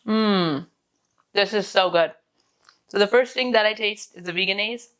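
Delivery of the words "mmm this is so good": savoring delicious food. Real, not acted. First bite of the sandwich.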